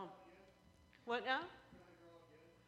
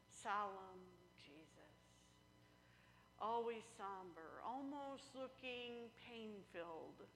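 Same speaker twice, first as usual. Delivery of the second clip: mock solemn; 4 dB quieter, slower than usual. Mimics somber paintings.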